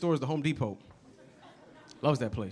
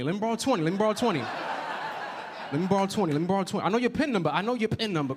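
lightly voiced cash machine begging; pestering beggar; 4 semitones higher than usual. Cash machine begging its customer for money, pestering. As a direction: wheedle quickly and repeatedly, pitch lifted.

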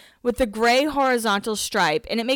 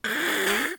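odd wordless mouth noise; silly imitated sound effect; imitated weird noise; 2 semitones higher, 4 dB quieter than usual. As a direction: mimic his weird little noises. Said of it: "starts making noises like".

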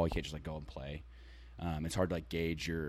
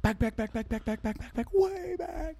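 announcer call. Retold or imitated. Imitating home run call.

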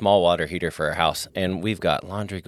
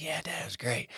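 lightly voiced happy little boy, higher and softer; imitating his son; 7 semitones higher, 13 dB quieter than usual. Father voicing his son enjoying a warm bath, higher and softer. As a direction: chirp it like a content kid in the tub. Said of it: "He's like".

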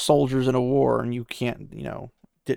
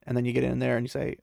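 normal talking voice

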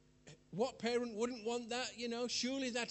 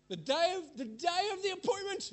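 high and louder disbelief; mock outrage; 11 semitones higher, 6 dB louder than usual. Preacher recalls the cancelled appointment, high indignant build. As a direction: climb up in disbelief.

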